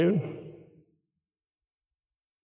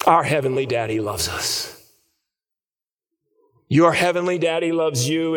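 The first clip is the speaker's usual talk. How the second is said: sermon application. Acted. Preacher telling the congregation God loves them, earnest sermon. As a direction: press the truth home to the church.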